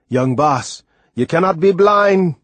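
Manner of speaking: put-on old sailor protesting loudly; Timothy in disbelief. A narrator's reading. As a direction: refuse to believe it, Timothy's voice climbing. Timothy denies boy's blindness.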